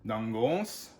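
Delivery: NANGOS with weight on the final O; nan-GOS, second syllable held; stress on the O. Shown as a demonstration. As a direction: lean on the long O in Nangos. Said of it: "the emphasis is on the O".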